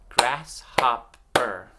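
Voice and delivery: "Grasshopper" slow and loud as grass, hop, per; syllable by syllable. Shown as a demonstration. Teacher giving children a word to blend themselves, syllable by syllable. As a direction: clap out "Grasshopper" with clear gaps. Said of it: "I'm gonna clap out".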